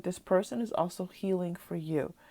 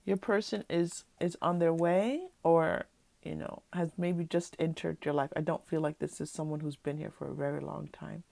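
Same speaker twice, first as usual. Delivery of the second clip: ordinary talk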